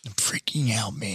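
softly whispered mock panic; whispered freak-out. Stoner confiding in friend that he's losing it, whispered.

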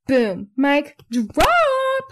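very high, stretched-out "drop"; mock triumph. Podcaster celebrating her own advice, mock-triumphant squeal. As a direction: blow up the last word into a squealed flourish.

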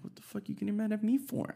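replaying his incredulous comeback; indignant outburst. Boyfriend snaps back at girlfriend over the period, mock outraged. Retold reply to angry girlfriend.

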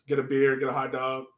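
usual speaking voice

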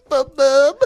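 laughing mimicry. Real, not acted. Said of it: "That's awesome".

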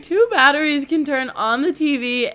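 loud, high incredulous retelling; mock amazement. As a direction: build to a hammed-up, astonished finish. Acted amazement at two batteries.

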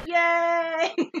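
a small squeaky cheer; mock cheer. Host echoing the cheer for Leguizamo, soft squeaky yay.

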